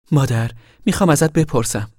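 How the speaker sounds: put-on a high, eager young pregnant girl asking her mother. Young pregnant Rose of Sharon asks.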